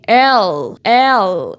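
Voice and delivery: "L" as a long "elll" with a dark final l; stretched, dark English "L"; drawn-out English L. Shown as a demonstration. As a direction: hold the end of "L" like an American. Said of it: "eles parecem que puxam muito mais".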